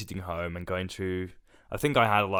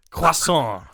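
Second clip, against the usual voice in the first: Croissant blurted high and loud as a French joke; mock French accent; 8 semitones higher, 9 dB louder than usual. Co-host jokes in a mock French accent to tease. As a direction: ham up a French word, loud. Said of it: "That was not a French accent".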